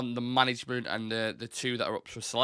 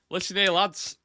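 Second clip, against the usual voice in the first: mock Potter team talk; 7 semitones higher, 3 dB louder than usual. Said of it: "he's going at dressing rooms going".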